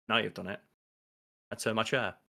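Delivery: understated, low-key line reading; quoted deadpan line. Retold or imitated. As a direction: lightly imitate a deadpan TV character. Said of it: "the way he delivers that line".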